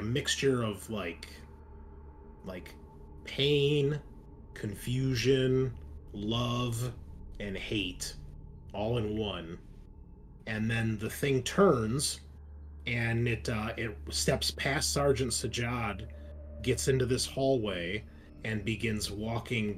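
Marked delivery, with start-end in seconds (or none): none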